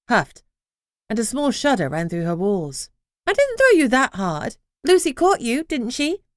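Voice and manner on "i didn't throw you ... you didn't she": higher, indignant house voice. A narrator's reading. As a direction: protest brightly as the living house. The house protests to the crown.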